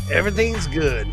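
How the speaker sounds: ordinary delivery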